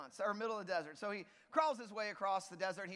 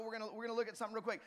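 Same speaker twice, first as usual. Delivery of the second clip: ordinary delivery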